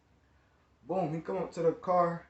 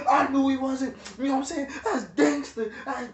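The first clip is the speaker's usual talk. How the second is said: higher, louder, hyped gloating; hammed-up cousin bragging he was right; annoying cousin; 7 semitones higher, 4 dB louder than usual. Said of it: "he's annoying".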